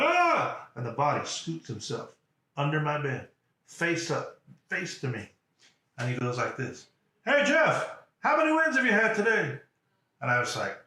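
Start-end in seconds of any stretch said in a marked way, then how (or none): 0.0-0.7 loud, very high scream; startled yell. Retold or imitated. Patient face down hears a body fall, high scream. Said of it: "sitting there in the circle going". 7.2-9.6 loud, high-pitched bellow; coach's yell. Retold or imitated. Coach under the bed to his player, loud high yell. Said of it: "You don't have to yell".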